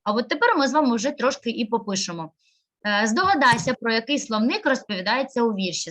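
ordinary delivery

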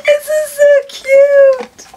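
high, drawn-out gushing squeal; delighted squeal. Real, not acted. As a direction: squeal slowly over something adorable. Gushing over a gift.

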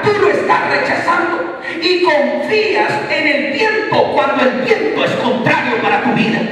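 high, loud fervent preaching; full-force preaching. Acted. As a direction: proclaim it to the whole church.

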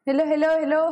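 loud high hellos to check the mic; repeated loud hellos; calling out. Real, not acted.

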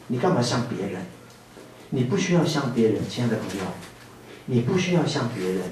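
soft, gentle comfort; soft and tender pastoral reassurance. Real, not acted. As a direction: soothe them softly like a pastor.

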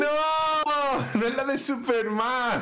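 high, drawn-out wail; slow high-pitched dismay; dismayed protest. Real, not acted. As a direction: deny it in a slow, high cry. Wailing at a wrong guess.

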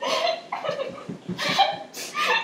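shrieking laughter, high pitched; high squealing laugh. Real, not acted. Friend chokes on cinnamon.

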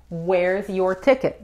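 slow, drawn-out "Where's your ticket?"; slow model phrase. Shown as a demonstration. Teacher modelling a new question for learners, slow and deliberate.

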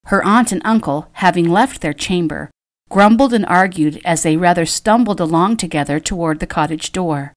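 usual speaking voice

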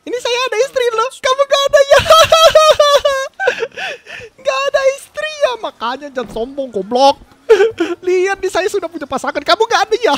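high, loud, fast gloating; loud mocking brag with laughter; laughing taunt. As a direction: cackle through the mockery.

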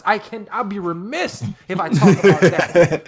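real excitement, laughing partway through; excited sudden recall. Real, not acted. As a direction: burst in as it comes back to you. Suddenly remembers a fight he left out.

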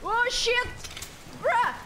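high startled cry. Real, not acted. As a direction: jump up high in shock. Startled by game event.

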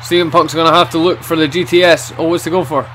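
lively ringside play-by-play; wrestling commentator. Acted. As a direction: call every move as it happens.

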